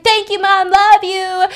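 put-on high, loud chirpy kid voice; sweet boy thanking his mom; happy little boy. Happy seven-year-old son.

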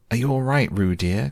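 high, worried motherly call; fretful mother checking on her child; anxious call. A narrator's reading. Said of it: "called Kanga anxiously".

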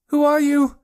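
high, loud frightened yell; shrieked high challenge. A narrator's reading. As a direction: yell it at an unseen intruder. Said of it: "yelled Joe".

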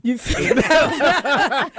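slow, laughing question. Real, not acted. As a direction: laugh as you ask. Host overcome after the song.

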